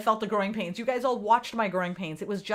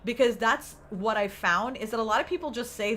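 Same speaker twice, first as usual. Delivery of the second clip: normal talking voice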